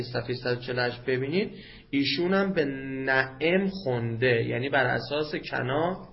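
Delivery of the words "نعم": "نعم" said na'em, second vowel e; kasra on the ayn. Shown as a demonstration. Teacher citing a Kufan reciter's reading, "نعم" pronounced na'em. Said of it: "به همین کسر عین".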